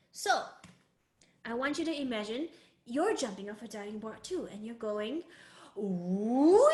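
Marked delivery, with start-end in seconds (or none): 5.8-6.7 "Wee!" starting low, then swooping up loudly; dipping third tone. Shown as a demonstration. Teacher imagines a diving board jump, "Wee!" dipping then rising. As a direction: dip "Wee!" down, then spring up. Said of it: "you're kind of going down first".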